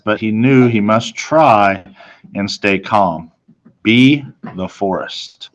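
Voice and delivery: plain reading voice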